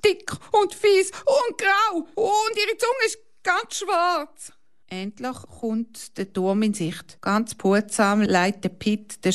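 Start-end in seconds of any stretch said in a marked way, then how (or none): 0.1-4.5 very high scared description; frightened shout. A narrator's reading. Girl describes the snake to the pilot, very high and scared. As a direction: shriek out what the snake looks like. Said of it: "schreit Hanna".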